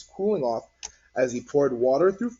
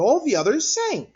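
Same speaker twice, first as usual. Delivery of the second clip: put-on higher, louder kid; excited child; 12 semitones higher, 6 dB louder than usual. A narrator's reading. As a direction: still showing off the rock. Child continues.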